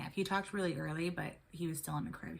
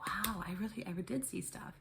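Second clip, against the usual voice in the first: awed whisper; 3 semitones higher, 4 dB quieter than usual. Retold or imitated. Recalls realising she saw things.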